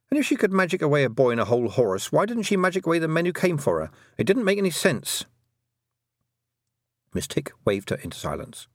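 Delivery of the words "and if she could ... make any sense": put-on high-pitched, worked-up girl character; indignant young Tiffany. Girl protesting that it made no sense, indignant. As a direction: argue it hotly, pitch climbing to the end.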